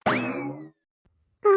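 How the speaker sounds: a high cracked cry bursting out; sudden sob. Acted. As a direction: let out one sharp sobbing wail. Sister breaks into a sob. (உடைந்து அழும் கூக்குரல்)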